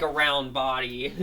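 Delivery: ordinary delivery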